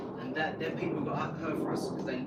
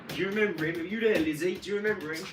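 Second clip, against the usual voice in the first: loud raised call across the room; 5 semitones higher, 8 dB louder than usual. Real, not acted. Calling out to Lizzie in another room, loud and raised.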